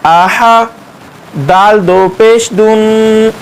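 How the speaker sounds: usual speaking voice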